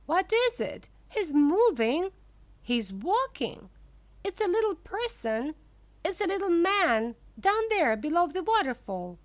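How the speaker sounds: high, breathless excitement; a girl's high excited cries. A narrator's reading. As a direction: squeal with discovery. Veruca spots a little man.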